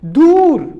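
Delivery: दूर with a long, drawn-out ू; drawn-out vowel. Shown as a demonstration. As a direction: stretch the oo in दूर. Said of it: "खींच कर पड़ा जाता है". (दूर में ू को खींच कर)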